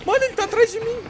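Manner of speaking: high panicked cry; being chased. Real, not acted. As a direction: cry out while fleeing. Monster right behind him.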